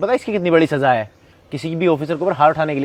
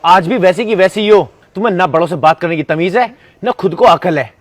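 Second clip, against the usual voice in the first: louder, heated telling-off; angry scolding; 3 semitones higher, 6 dB louder than usual. Acted. Son berates ex-girlfriend for her rudeness, heated loud scolding. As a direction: let her have it, loud and fed up.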